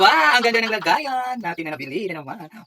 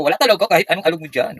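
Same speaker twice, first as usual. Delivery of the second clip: normal talking voice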